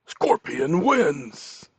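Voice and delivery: very slow, stretched announcer call; stretched game announcer. Retold or imitated. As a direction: draw it out like the arcade announcer. Mortal Kombat announcer.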